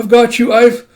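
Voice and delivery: high, loud, urgent reassurance; urgent calling. Acted. Ren reassures trapped Kippa, calling urgently. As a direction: call out while rushing to rescue. Rescuer calls to trapped friend.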